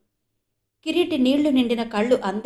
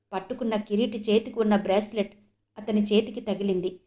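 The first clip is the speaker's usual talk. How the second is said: usual speaking voice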